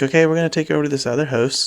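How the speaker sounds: put-on a touch of a Canadian accent on "house", said fast; fast, chirpy realtor line with "house" said like "hoose"; imitating Canadian realtor. Real estate agent showing buyers the next house, a light Canadian accent. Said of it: "She's like".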